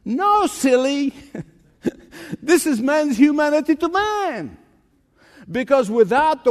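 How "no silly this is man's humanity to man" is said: mock shout. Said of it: "I want to shout".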